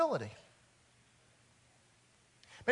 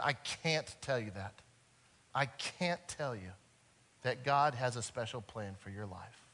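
much softer and lower, hesitant; uncomfortable, hushed minister; 9 semitones lower, 9 dB quieter than usual. A narrator's reading. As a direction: drop the voice, reluctant to say it. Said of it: "who's very uncomfortable with this whole conversation".

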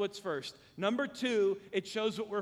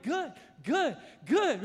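put-on a light, higher childlike "good"; smiling kid; 7 semitones higher, 5 dB louder, slower than usual. Kid watching the man eat his tortilla, lightly voiced and beaming. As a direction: beam it out like a proud boy. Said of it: "and he was smiling, like".

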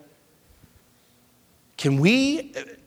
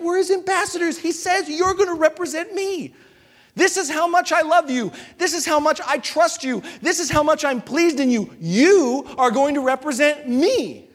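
raised, ringing preacher's voice voicing God; fervent preaching; 7 semitones higher than usual. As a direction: preach it out with full conviction. Preaching at full force, voicing God.

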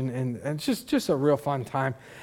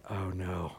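low, very soft retold dismay; hushed dismay; 9 semitones lower, 14 dB quieter than usual. Pastor recalls watching her take the bite, hushed dismay. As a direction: drop to a small, sinking aside. Helpless dread as she bites.

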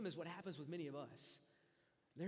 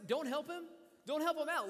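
mocking taunt; 8 semitones higher, 8 dB louder than usual. Mockers taunting the sufferer.

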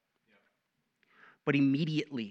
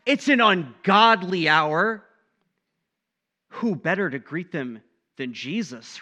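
ordinary delivery

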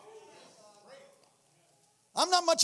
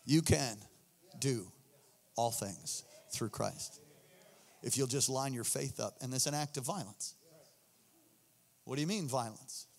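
hushed preaching; 9 semitones lower, 8 dB quieter than usual. Acted. Preacher quietly telling the church they can do all things, hushed. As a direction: drop it to a hushed intensity.

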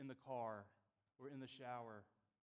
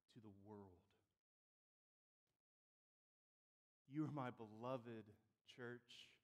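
tender, hushed; 4 semitones lower than usual. Acted. God to his beloved Israel and church, tender and hushed. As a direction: speak it gently, with long pauses.

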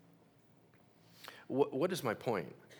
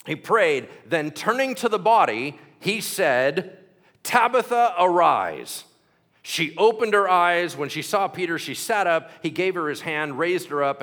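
raised, ringing Bible reading voice; scripture reading; 4 semitones higher, 9 dB louder than usual. Acted. Preacher reading scripture aloud to church, raised and ringing. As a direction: proclaim the passage to the congregation. Reads the Tabitha passage aloud.

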